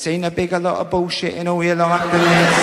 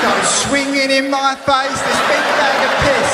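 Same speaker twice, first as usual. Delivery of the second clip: laughing; 7 semitones higher, 3 dB louder than usual. Real, not acted. Laughing at absurd scene.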